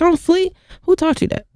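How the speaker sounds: high-pitched, questioning mom voice; puzzled and high; imitating puzzled mom. Acting out mom's puzzled question.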